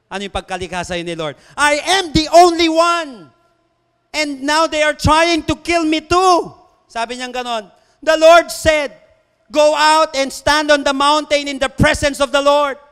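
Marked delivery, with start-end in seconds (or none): 1.6-12.8 loud, slow, high-pitched proclamation; full-force scripture preaching. Acted. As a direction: ring each phrase out to the congregation.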